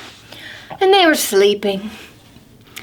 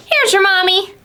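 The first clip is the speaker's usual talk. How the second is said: squirrel; 7 semitones higher, faster than usual. Acted. Squirrel voice in story.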